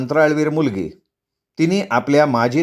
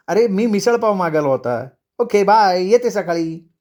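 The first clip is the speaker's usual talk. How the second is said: higher-pitched, offhand wife; breezy departing wife; 5 semitones higher than usual. Acted. Wife says bye till morning to husband, breezy. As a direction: toss off a cheery goodbye.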